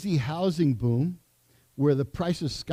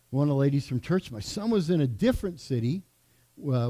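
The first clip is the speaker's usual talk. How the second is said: normal talking voice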